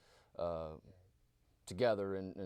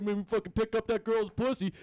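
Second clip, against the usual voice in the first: ordinary talk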